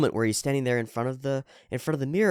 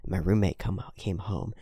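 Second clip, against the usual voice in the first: normal talking voice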